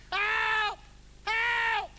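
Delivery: very high, loud call for help; cry for help. Acted. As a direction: call out across the sea. Castaway shouting for help.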